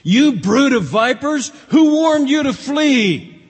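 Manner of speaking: high, loud, slow denunciation; fiery rebuke. Retold or imitated. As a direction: blast it like John. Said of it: "That's how he spoke".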